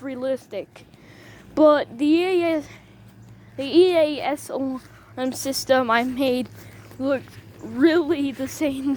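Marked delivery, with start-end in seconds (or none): none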